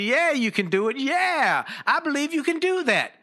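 high, swooping cheerleader pep; encouraging. Acted. Supporters telling you that you can, acted encouragement. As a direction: cheer them on with rising belief. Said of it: "who are encouraging you".

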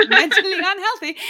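loud, high, laughed-out words; laughing. Real, not acted. Podcast guest joking about own mental health, laughing.

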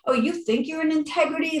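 mocking taunt. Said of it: "the universe throws it in your face".

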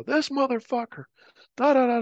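high, mocking blah-blah voice; mock high babble. Aircrew mocking the guy's excuses, high and silly. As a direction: squeak it out like nonsense chatter.

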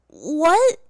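sharp, loud, high What?; bellowed. A narrator's reading. Aang hearing his world is frozen, bellowing. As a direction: bellow it out in shock. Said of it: "Aang bellowed".

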